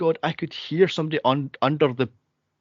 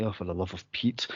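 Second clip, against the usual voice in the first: low, much softer fed-up grumble; exasperated mutter; 8 semitones lower, 11 dB quieter than usual. Retold or imitated. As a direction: sigh it out under your breath, lower and softer. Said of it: "So I'm like".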